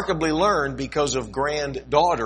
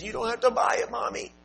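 put-on much higher sweet child voice; little girl coaxing; 12 semitones higher than usual. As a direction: coax mommy like a small girl. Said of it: "She said".

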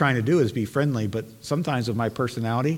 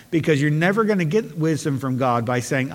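raised, loud, driving pulpit voice; fervent revival preacher building the crowd; full-force preaching; 4 semitones higher, 4 dB louder than usual. Acted. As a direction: preach it hard to the congregation.

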